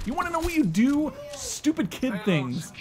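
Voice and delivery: a squeaky, mocking question; mock high voice. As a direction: jump way up high and fast. Answering own question, pitch jump.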